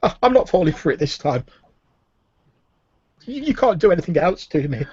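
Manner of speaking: high-pitched tearful defiance; sobbing prisoner. Acted. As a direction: stammer it out as a tormented captive who trusts nobody. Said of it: "He, he sobs and says".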